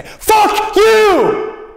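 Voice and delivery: father screaming at his son, piercing yell; screamed curse. Retold or imitated. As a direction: explode at the kid at full volume. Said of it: "Sometimes he'd just look at me and say".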